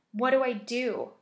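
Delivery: loud, slow desperate plea; desperate begging. A narrator's reading. Terrified girl asking her friend for help, begging. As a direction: beg for help, panicked and loud. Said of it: "I begged".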